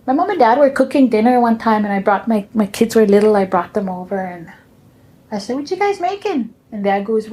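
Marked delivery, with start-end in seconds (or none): 5.6-6.5 high curious question. Retold or imitated. Asking parents cooking.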